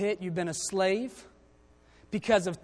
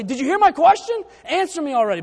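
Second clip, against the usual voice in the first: higher, louder, exasperated Joseph; impatient demand; 7 semitones higher, 9 dB louder than usual. Retold or imitated. Preacher voicing Joseph demanding an answer from his brothers, impatient and loud. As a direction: snap at the silent brothers. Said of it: "I'd say".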